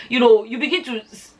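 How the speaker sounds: ordinary delivery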